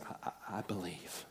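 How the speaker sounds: soft, low, halting admission of faith; hushed confession. Retold or imitated. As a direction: drop to a near-whisper, stumbling into the words. Thomas's humbled confession retold.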